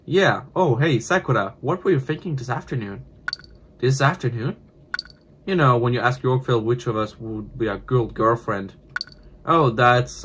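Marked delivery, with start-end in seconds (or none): none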